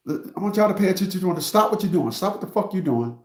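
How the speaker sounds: usual speaking voice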